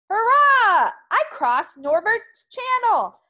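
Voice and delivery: loud, high hurrah of victory; triumphant cheer. Acted. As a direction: cheer it out like a proud winner. Norbert cheers after his swim.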